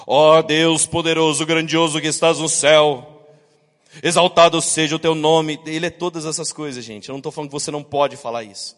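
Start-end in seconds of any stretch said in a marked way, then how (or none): 0.1-5.6 mock solemn loud prayer; pompous prayer voice. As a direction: declaim to God like a cathedral. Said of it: "Vamos lá, filho".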